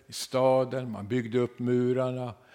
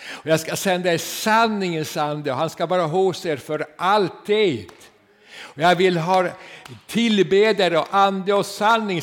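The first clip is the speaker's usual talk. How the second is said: loud, raised proclaiming; fervent scripture quote; 7 semitones higher, 10 dB louder than usual. Acted. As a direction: cry out the promise to the congregation. Quoting Jesus in sermon.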